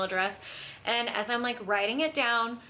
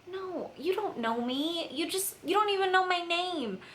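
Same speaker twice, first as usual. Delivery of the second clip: incredulous protest; 8 semitones higher than usual. Retold or imitated. Telling the saleswoman she doesn't know her, incredulous. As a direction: push back in disbelief at a stranger. Refusing stranger's request.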